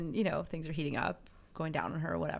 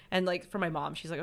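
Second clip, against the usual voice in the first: ordinary delivery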